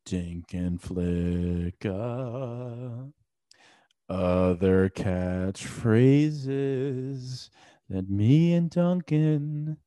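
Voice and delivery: very slow, stretched-out delivery; milk every word slowly; slow dramatic drawl. Pretend. Host mock-solemn about their tired catchphrases, slowly.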